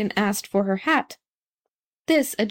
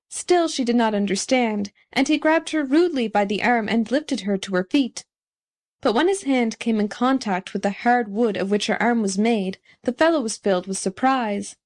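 usual speaking voice

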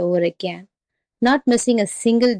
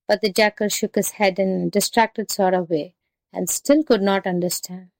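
usual speaking voice

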